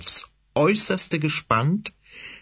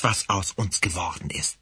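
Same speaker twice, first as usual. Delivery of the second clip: ordinary delivery, nothing marked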